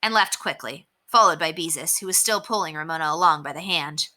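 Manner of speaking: plain reading voice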